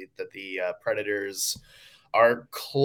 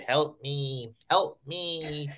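small robotic cry for help; tiny machine voice calling out; 5 semitones higher, 4 dB quieter, much slower than usual. Retold or imitated. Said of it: "A little robotic voice".

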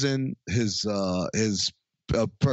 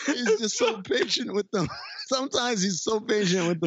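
high-pitched, talking through laughter; laughing, voice lifted well up; real laughter; 8 semitones higher than usual. Real, not acted. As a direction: crack up while getting the words out. Cracking up at the retold joke.

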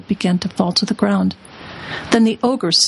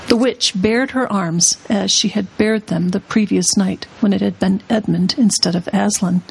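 plain reading voice

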